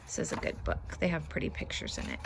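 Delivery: soft whispered aside; hushed remark about the book. Real, not acted. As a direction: murmur it under your breath.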